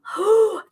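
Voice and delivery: sharp high gasp of wonder; mock gasp of amazement; amazed gasp. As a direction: gasp as if a door just opened. Awed at discovering a new room.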